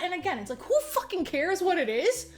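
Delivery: usual speaking voice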